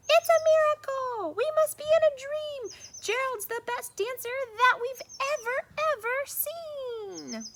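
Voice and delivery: very high, loud astonished cheer; amazed shout. Acted. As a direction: shout in wonder. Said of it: "They shouted".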